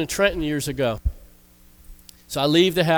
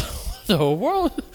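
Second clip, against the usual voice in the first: mock bewildered stare; 6 semitones higher, much slower than usual. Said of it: "people probably driving by like".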